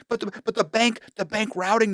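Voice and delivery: high, protesting stammer; flustered agent. Retold or imitated. Agent puzzled by the bank routing number, flustered. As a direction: protest in a high mock-confused voice.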